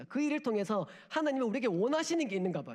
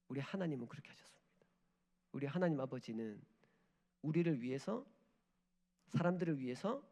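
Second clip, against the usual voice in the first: hushed solemn; 5 semitones lower, 6 dB quieter than usual. Real, not acted.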